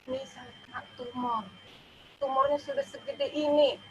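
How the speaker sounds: tearful confession. Retold or imitated. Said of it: "saya menangis sama Pak Pendeta".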